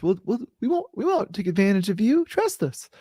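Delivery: high-pitched mock-innocent promise; put-on, squeaky-high "trust me" act; mock reassurance. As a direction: play a slippery official pleading sweetly in a high voice. Mocking central bankers' promises.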